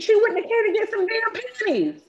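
exasperated, incredulous outburst; high, disbelieving rant. Real, not acted. Exasperated rant about the store.

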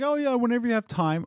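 high, quick, airy mock boss; mocking the owner. Mocking the owner's casual request, high and breezy. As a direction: brush it off in a silly high voice. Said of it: "He's like".

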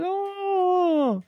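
high drawn-out kitten wail; mock cry in a tiny high voice; long, high pretend whimper. A narrator's reading. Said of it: "then Mitten cried and said".

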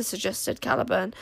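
ordinary delivery

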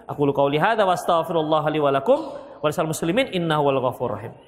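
low, recited closing formula; formal Arabic closing prayer. Acted. Preacher closing his sermon to the congregation, recited low. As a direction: intone the closing prayer steadily.

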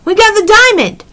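high, eager news-breaking; excited announcement. A narrator's reading. Annie tells the invisible children good news, high and excited.

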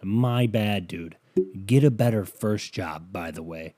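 drip sarcasm at his excuse; sarcastic mock reply. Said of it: "My response to that would have to be".

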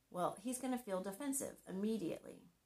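ordinary delivery

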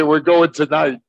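mock eager soldiers. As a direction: blurt it out, half laughing. Retold soldiers decide to attack.

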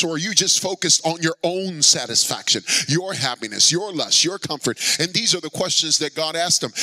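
ordinary talk, nothing marked

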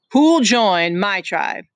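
chief Jack calling out slowly; lording it over the boys; slow, loud challenge. Acted. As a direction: draw it out loud to the crowd. Jack's final call.

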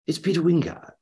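high, honeyed actor announcing himself; Peter Wyngarde. Retold or imitated. Famous actor names himself on the phone, honeyed. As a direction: imitate a textured, theatrical voice. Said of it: "And, and this voice said".

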